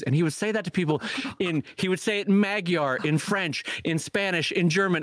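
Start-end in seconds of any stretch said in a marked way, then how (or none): none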